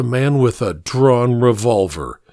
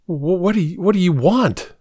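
stammering in terror, pitch higher; a frightened man facing a gun, stammering higher; 6 semitones higher than usual. A narrator's reading. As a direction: get the words out through fear, tripping on each start. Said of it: "I finally managed to stammer".

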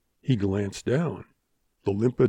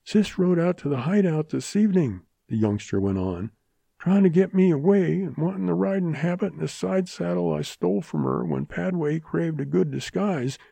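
higher wounded youngster voice; young man voice; 7 semitones higher than usual. A narrator's reading. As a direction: speak like a feverish youngster.

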